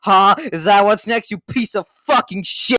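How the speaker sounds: hammed-up angry interrogation, building in pitch; mock outrage. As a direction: rant at them in exaggerated fury.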